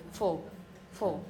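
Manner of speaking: "full" as a short, falling "fl" syllable; reduced -ful ending. Shown as a demonstration. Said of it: "But think of full".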